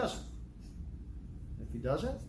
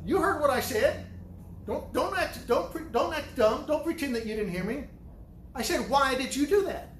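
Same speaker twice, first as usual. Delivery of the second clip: stern scolding; 8 semitones higher than usual. Retold or imitated. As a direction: snap at him not to act dumb, pitched up hard. Father scolding lying son.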